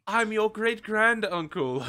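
slow, high, loud theatrical reveal; Arden. Retold or imitated. Host parodying a villain revealing his kinship, slow and theatrical. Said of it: "he was really good as Arden".

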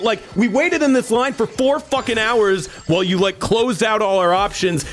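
high, sustained indignant tirade; retold angry yelling. Customer who waited hours in line, yelling at the agent. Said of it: "I straight up yelled at her".

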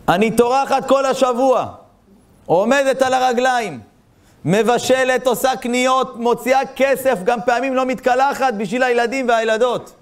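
high-pitched, insistent list of chores; high, drawn-out grievance; aggrieved mother. Retold or imitated. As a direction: act out an overworked mother's complaint. Voices overworked mother's complaint to rabbi.

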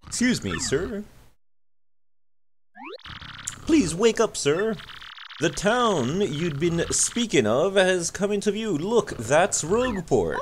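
gently waking the passenger; polite sailor. Acted. Voices the ship sailor.

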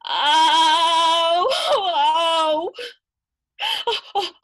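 high, loud pained wails; wailing cry. Acted. Old grandmother wails as the girl licks her wounds, loud and high. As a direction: cry out each oh in pain and release. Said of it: "would yell out and cry".

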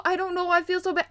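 ordinary delivery

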